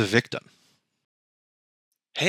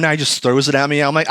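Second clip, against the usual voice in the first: normal talking voice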